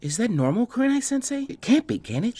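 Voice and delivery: put-on high, timid girl's voice, stammering in awe; a bashful girl stunned by what she sees; shy Hinata. Hinata voiced, worried question.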